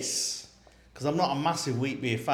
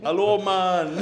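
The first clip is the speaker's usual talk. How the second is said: higher, louder, slow mock Jamaican greeting; a playful fake Jamaican hello, laughing; jokey Jamaican accent; 7 semitones higher, 6 dB louder, slower than usual. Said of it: "That's Jamaican".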